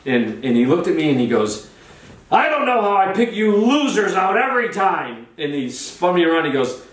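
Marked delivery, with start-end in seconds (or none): 2.3-5.3 high, hollered complaint; mock exasperation. Charlie berates the loser he picked on stage, mock exasperation. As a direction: imitate a showman fed up with his volunteer.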